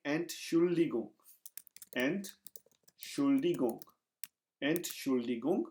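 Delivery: Entschuldigung drawn out very slowly; slow pronunciation demo. Shown as a demonstration. Teacher models Entschuldigung slowly for students. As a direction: stretch out Entschuldigung syllable by syllable. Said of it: "मैं इस तरह से आपको tip दे सकता हूँ".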